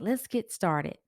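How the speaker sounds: plain reading voice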